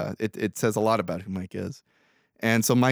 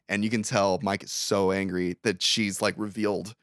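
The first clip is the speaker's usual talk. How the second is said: ordinary talk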